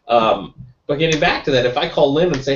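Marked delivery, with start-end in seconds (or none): none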